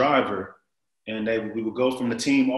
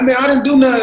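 much higher, louder shocked yelp; shocked what; 14 semitones higher, 11 dB louder, faster than usual. Retold or imitated. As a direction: blurt it out in disbelief. Protesting innocence, shocked.

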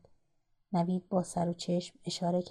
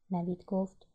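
plain reading voice

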